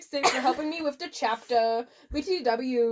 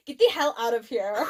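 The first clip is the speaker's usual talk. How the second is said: ordinary delivery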